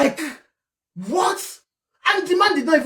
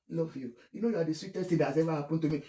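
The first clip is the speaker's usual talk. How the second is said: much softer, fast lovesick murmur; dreamy lover's murmur; 6 semitones lower, 11 dB quieter, much faster than usual. Retold or imitated. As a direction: murmur sweet nothings into the phone. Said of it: "speaking like this".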